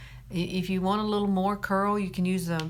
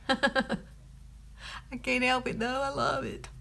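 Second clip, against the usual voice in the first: high, laughing admission; giggling confession; 7 semitones higher, much slower than usual. Real, not acted. Vlogger admitting she loves makeup, giggly and high. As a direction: say it through a giggle, pitched up.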